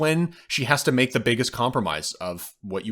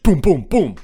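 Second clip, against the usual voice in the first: three loud, punched booms; imitated gunshots; 7 semitones higher, 9 dB louder than usual. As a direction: punch out each shot by mouth. Imitating three execution shots.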